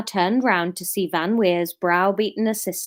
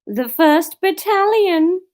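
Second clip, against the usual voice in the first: Kenneth Williams voice; 9 semitones higher, 5 dB louder, slower than usual. Acted. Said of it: "Kenneth Williams".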